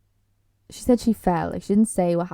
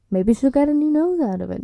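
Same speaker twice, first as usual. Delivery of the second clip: high, louder cheeky quip; joking aside; 7 semitones higher, 6 dB louder than usual. Real, not acted. Viewer cracking a joke about her broken nose, cheekily.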